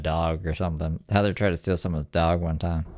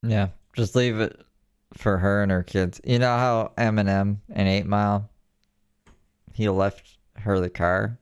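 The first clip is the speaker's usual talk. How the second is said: ordinary talk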